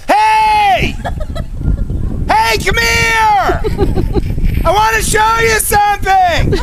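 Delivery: high, huge, drawn-out holler; crying out. Shown as a demonstration. Performer crying out to people walking by, long loud shout. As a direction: bellow slowly to strangers far away. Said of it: "cry out to the people walking by".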